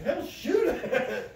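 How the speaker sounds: high, slow, laughing shoot it; high laughing dare; laughing urge. Retold or imitated. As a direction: egg him on through laughter.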